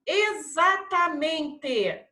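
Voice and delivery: "Exatamente" very slow, syllable by syllable with a z. Shown as a demonstration. Teacher showing pupils X as Z, "Exatamente" slowly sounded out. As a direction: stretch out "Exatamente", buzzing the z. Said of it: "Tem som de Z".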